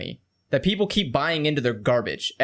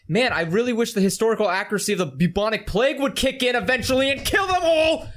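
mocking exasperation; 7 semitones higher than usual. Frustrated gamer quote.

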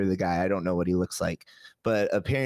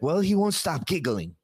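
imitating the wife; 8 semitones higher than usual. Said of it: "And she's like".

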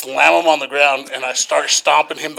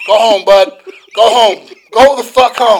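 loud, raised-pitch, insistent command; re-enacted shouted order; 6 semitones higher, 10 dB louder than usual. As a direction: send a drunk friend away, hollering. Re-enacting ordering drunk friend home.

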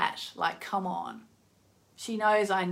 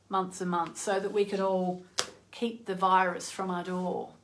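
ordinary talk, nothing marked

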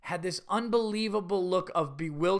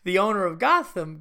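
ordinary talk

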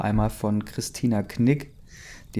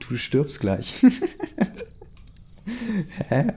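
laughing; 9 semitones higher, slower than usual. Real, not acted. Laughing at the drama.

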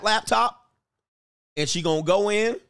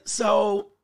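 sly opener; 4 semitones higher than usual. Retold or imitated. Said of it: "they'll be sitting there like this".

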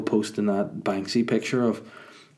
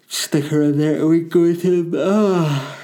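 gleeful; 8 semitones higher, 8 dB louder, slower than usual. Real, not acted. Excited plan to leave the baby.

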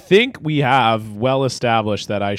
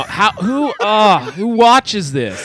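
incredulous; 6 semitones higher, much slower than usual. Real, not acted. Incredulous at gross video.

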